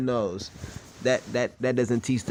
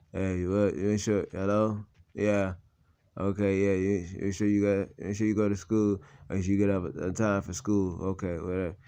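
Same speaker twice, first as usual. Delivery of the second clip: lower, quieter lazy father voice; mocking father on phone; 4 semitones lower, 4 dB quieter than usual. Son mimics absent father's phone calls. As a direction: mimic his hollow phone check-in. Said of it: "But he'll call me up".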